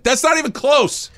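high-pitched mock yell; retold yell. Parent protesting an egregious balk, retold yell. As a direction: re-enact an exasperated call from the stands. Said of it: "I yelled out".

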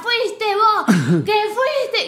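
high-pitched Argentine retort; Argentine couple quarrel. Retold or imitated. Mimicking the other spouse's accusation, Argentine quarrel. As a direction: throw the blame back, high, in Argentine accent. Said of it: "Y el otro le decía".

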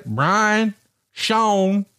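a light imitation of his drawled "Brian, Sean"; lightly voiced Southern drawl on "Brian" and "Sean"; imitating his pronunciation. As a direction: mimic the way Jeff says "Brian" and "Sean". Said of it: "my two favorite words that you pronunciate".

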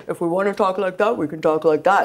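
a quick silly talking voice; playfully distort your voice; funny put-on voice. Shown as a demonstration. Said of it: "Hey, Shania, don't do that".

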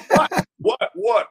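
high-pitched, baffled mimicry of a stunned man; mock stunned reaction. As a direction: imitate his dumbfounded "what?" in a high voice. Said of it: "that bald little head of his was like".

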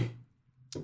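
normal talking voice

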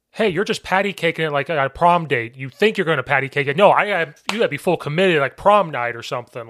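coach's rant. Retold or imitated. Coach retelling how he gets on linemen, loud rant. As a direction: fire it at the linemen, fast and loud.

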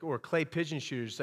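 ordinary talk, nothing marked

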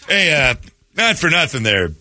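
usual speaking voice

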